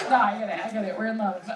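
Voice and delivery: normal talking voice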